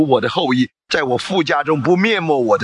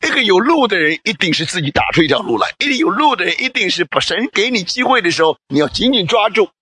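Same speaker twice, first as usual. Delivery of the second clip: higher, driving exhortation; fervent preaching; 6 semitones higher, faster than usual. Acted. Preacher exhorting the congregation to seize God's chance, fervently. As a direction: urge them on with rising fire. Urges congregation with rising force.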